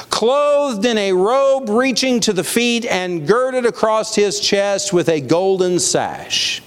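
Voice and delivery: high, slow proclaimed Bible reading; scripture reading. A narrator's reading. Preacher reading John's vision to the congregation, proclaiming.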